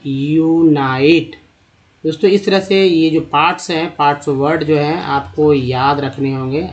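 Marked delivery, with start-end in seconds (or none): 0.1-1.4 यूनाइट drawn out very slowly; teacher spelling out यूनाइट by ear; very slow sounding out. Shown as a demonstration. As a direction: stretch यूनाइट to show the यूनाई part. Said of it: "यूनिसाइकिल".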